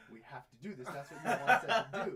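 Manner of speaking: put-on high, loud kid's protest broken by laughter; quoting kids while laughing. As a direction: laugh through the kids' insistent words. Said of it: "But they were like".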